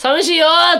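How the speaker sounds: high, drawn-out pleading whine; mock lonely whine. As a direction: whine like an abandoned kid. Retold lonely plea.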